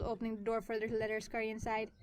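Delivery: plain reading voice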